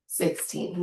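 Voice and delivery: normal talking voice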